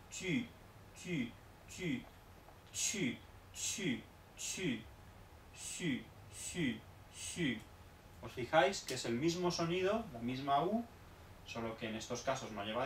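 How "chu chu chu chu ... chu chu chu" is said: chu softer, with rounded ü vowel; ju with ü sound. Shown as a demonstration. Teacher drills ju, qu, xu with the French u. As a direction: say chu with lips round, tongue like i. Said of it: "Por lo tanto".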